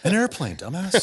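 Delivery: laughing tease. Retold or imitated. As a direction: tease him through a laugh. Teasing reply to friend.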